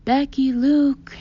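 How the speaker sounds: slow, loud call across the street. A narrator's reading. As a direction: call them from afar. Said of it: "Dad calls".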